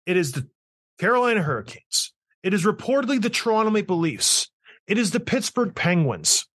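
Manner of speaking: ordinary delivery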